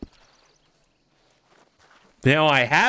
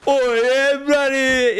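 much higher, louder mock English voice; exaggerated English accent; 12 semitones higher, 4 dB louder, slower than usual. Streamer mocking how English people talk, hammed-up. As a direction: ham up a plummy Englishman. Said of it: "I'm over exaggerating".

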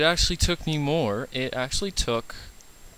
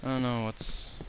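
ordinary delivery

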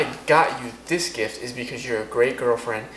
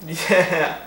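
usual speaking voice

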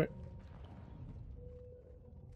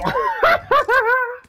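high laugh. Real, not acted. Laughs at enemy.

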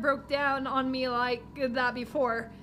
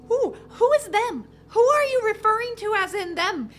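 higher, louder demanding voice; alarmed question; 7 semitones higher, 5 dB louder than usual. Acted. Girl pressing the driver about them, higher and louder. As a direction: demand an answer, rattled.

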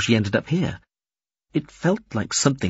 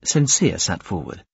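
ordinary delivery, nothing marked

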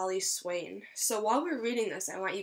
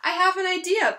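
higher and louder, bursting with an idea; eager idea; 8 semitones higher, 7 dB louder than usual. Acted. Pedro telling his friends about his plan, eager and loud. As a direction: pop the line out like a bright idea. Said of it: "Pedro said to his friends".

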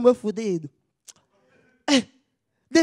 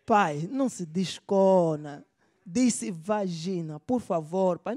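slow embarrassed correction; 3 semitones lower, much slower than usual. Acted. Embarrassed son correcting slowly.

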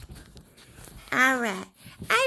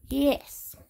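ordinary talk, nothing marked

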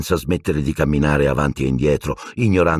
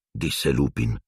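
ordinary delivery, nothing marked

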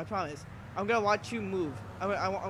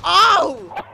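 pained yelp into laughter; 9 semitones higher, 12 dB louder than usual. Real, not acted. Hit while crossing, laughs.

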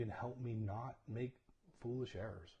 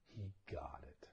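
soft, lower whispered repeat; 7 semitones lower, 8 dB quieter than usual. Real, not acted. Pastor quietly repeating that his son understood, whispered. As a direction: whisper the point home.

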